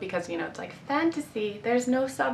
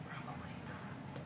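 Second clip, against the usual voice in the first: breathy wry whisper; whispered aside; 22 dB quieter than usual. Real, not acted. Admitting she won't study, whispered. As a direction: whisper the confession.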